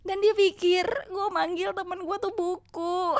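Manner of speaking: ordinary delivery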